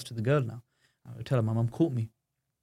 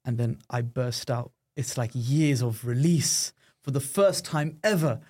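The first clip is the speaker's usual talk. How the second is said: usual speaking voice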